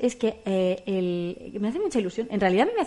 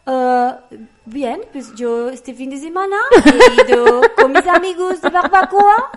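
hammed-up fake French gibberish, higher and louder; mock fake French; 6 semitones higher, 10 dB louder, slower than usual. Applicant bluffing fake French to the interviewer. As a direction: put on a made-up French accent.

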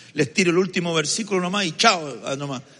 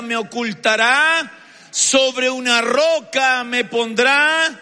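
raised, slow, sermon cadence on each promise; full-force preaching; 10 semitones higher, 7 dB louder than usual. Acted. Pastor proclaims Psalm 27 to the congregation, fervent preaching.

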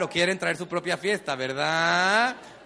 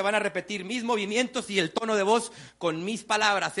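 usual speaking voice

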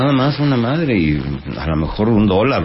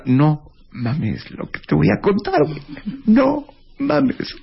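high, shocked squealing voice; excited friend; 13 semitones higher than usual. Retold or imitated. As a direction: squeal it out in disbelief. Friend's shocked reaction in joke.

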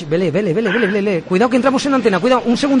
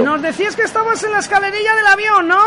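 bright, raised on-air host voice; radio announcer; 12 semitones higher than usual. Acted. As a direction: sell it like a live radio host.

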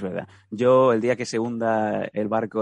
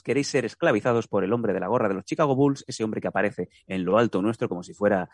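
normal talking voice

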